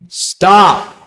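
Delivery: loud, high, emphatic order to stop; forceful command. Real, not acted. Cuts off friend's excuse sharply.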